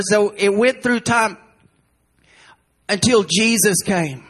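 normal talking voice

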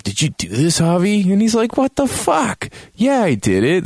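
high-pitched retold outburst; mock indignation. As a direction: jump up high in hammed-up disbelief. Acts accusation and indignant confession.

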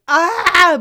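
loud, high "ah" of exasperation; frustrated mock yell. Parent stuck with a kid's music, exasperated mock scream. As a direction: cry out as if driven bananas by the music. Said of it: "I'm like".

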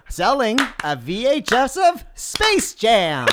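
high, slow singer impression. Guest improvising an answer in the game, campy impression. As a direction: stretch the answer out high in rhythm. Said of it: "an impersonation of [name]".

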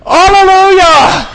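long, high, full-force shout; drawn-out thunderous cry of praise; mighty thundering voice. Acted. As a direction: roar it out like a vast multitude. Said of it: "the voice of mighty thundering".

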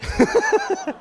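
high amused laugh at his own bad luck; short real chuckle over a ruined trip. Real, not acted. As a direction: laugh it off, rueful. Laughs at missing the scenery.

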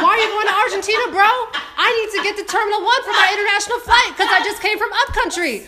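high, loud, laughing rant at the airline; exasperated, laughing, incredulous; laughing mock rant. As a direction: scold the airline while cracking up.

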